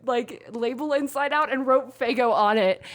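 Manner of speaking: ordinary talk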